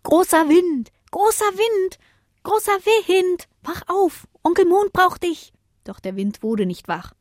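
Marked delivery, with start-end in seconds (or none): 0.0-5.5 very high, small calling voice; high-pitched, singsong wake-up calls; little stars calling out. A narrator's reading. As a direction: call out to rouse a sleeper. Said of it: "Mit lautem Rufen versuchten sie".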